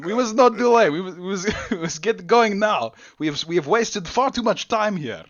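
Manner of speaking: high, loud, slowed put-on voice; guard's attempted accent. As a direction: press them to hurry in a hammed-up voice. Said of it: "I can't do his accent".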